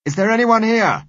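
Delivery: loud high call down the corridors. A narrator's reading. Searching the empty building, calling out loudly. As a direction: shout it to be heard far off.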